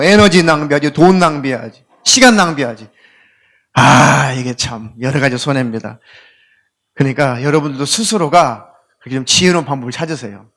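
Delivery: ordinary delivery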